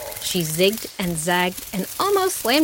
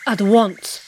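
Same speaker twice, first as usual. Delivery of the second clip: louder curt royal order; commanding; 5 dB louder than usual. A narrator's reading. Monkey king interrupting the dragonfly's plea, commanding. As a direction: cut her off with the order. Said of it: "he repeated".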